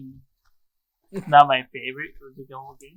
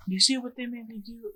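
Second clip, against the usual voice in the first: higher, blame-shifting whine; mock-innocent Odin; 6 semitones higher, 4 dB quieter than usual. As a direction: play the victim, pointing at someone else.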